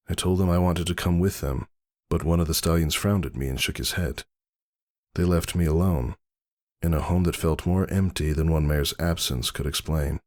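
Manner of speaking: usual speaking voice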